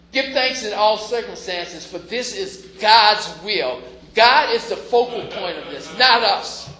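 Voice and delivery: preaching at full force. Acted. Preaching climax.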